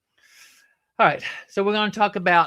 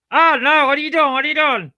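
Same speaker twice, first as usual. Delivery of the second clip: much higher, louder alarmed cry; startled alarm; 9 semitones higher, 10 dB louder than usual. Real, not acted. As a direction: yelp it out in sudden alarm. Puppet hurts, sudden alarm.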